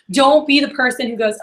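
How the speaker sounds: usual speaking voice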